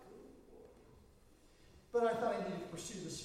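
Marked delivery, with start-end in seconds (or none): 0.1-0.7 whispered, exasperated aside. Retold or imitated. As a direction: breathe it out under your breath in disbelief. Reacting to Bill's excuse.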